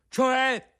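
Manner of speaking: high, loud startled cry; alarmed. A narrator's reading. Pinocchio alarmed by the marmot's news, startled high cry. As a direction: jump at the bad news.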